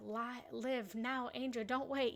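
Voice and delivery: ordinary talk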